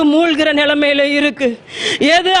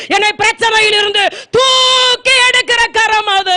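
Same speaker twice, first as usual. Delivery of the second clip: building from raised to a high, shouted climax; preaching at full force; 3 semitones higher than usual. Acted. Preacher rousing the congregation about God's lifting hand, building to a shout. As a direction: pile it up line by line until you shout.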